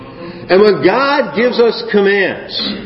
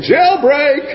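comic alarm; 10 semitones higher than usual. Retold or imitated. Inner thought, comic alarm.

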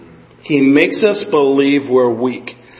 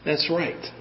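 quiet, to oneself; hushed, inward amen; internalized affirmation; 11 dB quieter, slower than usual. Acted. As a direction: say it softly, as if privately agreeing. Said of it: "would you internalize that and go".

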